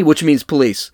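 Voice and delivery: ordinary delivery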